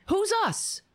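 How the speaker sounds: sharp high outraged questions; incredulous. Real, not acted. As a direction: fire back in disbelief. Mocking Trump's rally line.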